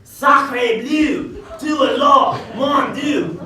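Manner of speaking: hammed-up French accent, exclaiming; a mock Frenchman blustering in a French accent. Servant voicing a French intellectual, in a hammed-up French accent. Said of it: "I believe he's a French intellectual".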